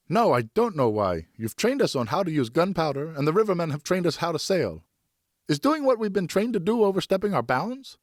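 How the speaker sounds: put-on defiant boy arguing with father. A narrator's reading. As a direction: play a stubborn son pleading his case. Geoffroy angry defiant outburst.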